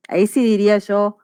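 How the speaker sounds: ordinary talk, nothing marked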